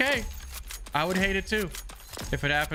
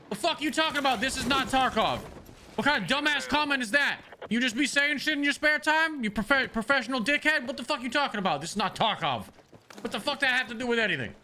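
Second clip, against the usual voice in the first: heated, higher-pitched rant at a commenter; angry; 3 semitones higher than usual. Real, not acted. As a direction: tear into a chatter in real irritation. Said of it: "That's why I'm so angry".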